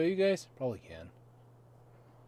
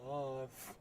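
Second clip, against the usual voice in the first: normal talking voice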